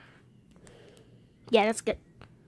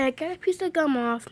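ordinary talk

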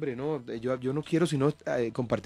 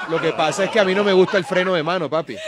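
loud, higher-pitched playful objection; mock indignant protest; 5 semitones higher, 8 dB louder than usual. Real, not acted. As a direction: object loudly over the laughter. Mock protest amid studio laughter.

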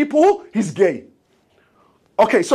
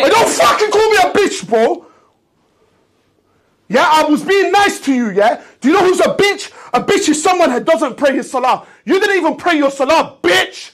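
much higher and louder, shouting him down for real; real angry shouting; 6 semitones higher, 4 dB louder than usual. Real, not acted. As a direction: yell back hard at the insult. Snaps back after being called a bitch.

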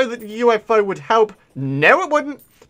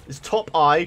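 ordinary talk

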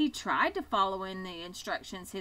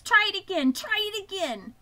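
high, urgent goose cheer; crying goose; 11 semitones higher, 8 dB louder than usual. A narrator's reading. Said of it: "cried the goose".

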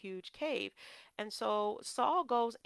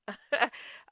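brief, louder chuckle; real laugh at one's own remark; amused chuckle; 3 semitones higher, 5 dB louder than usual. Real, not acted. Preacher on couples together all the time, chuckling.

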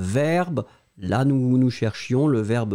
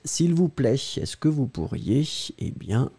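ordinary delivery

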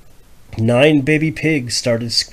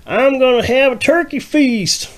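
high loud vow; vowing farmer; 14 semitones higher, 6 dB louder than usual. Acted. The farmer vowing to the crowd, high and loud. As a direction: declare it to the crowd. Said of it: "Mister Farmer vowed".

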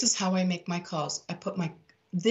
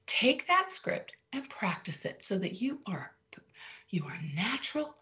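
whisper; 3 semitones higher, 5 dB quieter than usual. Real, not acted. Whispered advice.

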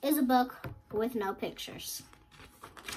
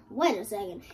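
plain reading voice

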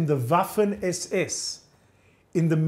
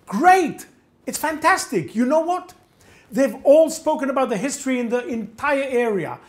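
high, loud burst of excited approval; delighted enthusiasm; 7 semitones higher, 4 dB louder than usual. Retold or imitated. Gendarme welcoming a documentary maker, delighted enthusiasm. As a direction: gush with sudden eagerness, pitch way up.

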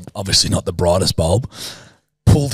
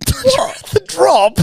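high, laughing disbelief; slower than usual. Real, not acted. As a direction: laugh through the correction. Laughing at mistake.

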